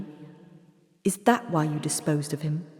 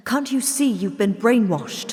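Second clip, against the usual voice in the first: high, loud exasperated plea; urgent plea; 6 semitones higher, 5 dB louder than usual. Acted. Doctor pleading loudly with brainwashed mother. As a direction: shake her awake with words.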